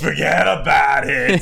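slow Boston "Forget about it"; drawn-out Boston wiseguy line; Boston accent. Pretend. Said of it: "So I'm giving a Boston accent".

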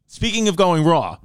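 ordinary talk, nothing marked

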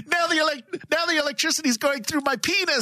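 high-pitched comic wail; hammed-up victim in torment; mock agony. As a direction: cry out as if being electrocuted. Hammy skit, electrocuted character wailing.

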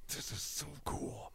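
whispered under the breath, slow; hushed private thrill; awed whisper. Retold or imitated. Dad quietly awed watching his son at the dump. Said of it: "and I'm just like".

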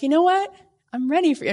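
high, loud, bright declaration; eager. Retold or imitated. Mother at the end of pregnancy, eager and loud. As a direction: announce you are finally ready. Ready for baby after forty weeks.

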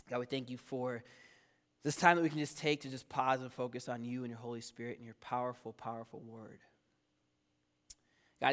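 low, soft public prayer. Real, not acted. Pastor prays for the church before preaching, low earnest prayer. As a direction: talk to God quietly and sincerely.

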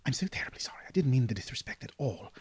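whispered, embarrassed sorry; hushed apology. Acted. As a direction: apologize under your breath at the graves. Apologizing quietly at a funeral.

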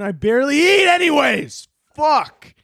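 high-pitched yelled protest; exasperated shout. Retold or imitated. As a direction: retell it as an outraged yell back. Retort to doctor about salt.

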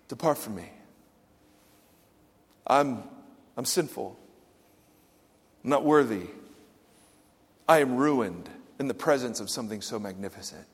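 low, soft, broken-down voice; a hushed sinner's plea; hushed confession. Retold or imitated. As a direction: confess it quietly, ashamed.

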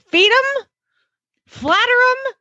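high, sing-song imitation of an old lady; old lady's advice. Quoting an old lady's marriage advice, high and sing-song. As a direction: lift it up like a sweet old lady handing down wisdom. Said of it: "She said".

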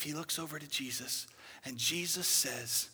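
usual speaking voice

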